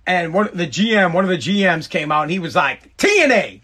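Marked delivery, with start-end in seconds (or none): 3.0-3.6 high, punchy Texan holler; hammed-up Texan demand; Texan boss impression. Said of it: "The guy was from Texas".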